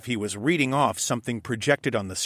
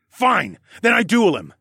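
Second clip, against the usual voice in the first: louder, higher furious declaration; raging; 11 semitones higher, 8 dB louder than usual. A narrator's reading. Husband vowing to duel the kidnapper, loud rage. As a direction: throw the challenge out in fury. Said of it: "I raged".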